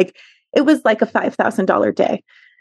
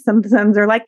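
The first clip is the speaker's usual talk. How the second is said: ordinary talk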